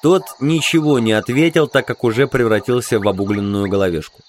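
ordinary delivery

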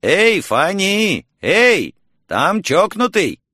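high, drawn-out holler; calling out. A narrator's reading. Mrs. Gutierrez hollering to Fanny on the second floor. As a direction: call up to a neighbour on another floor. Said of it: "крикнуть второму этажу".

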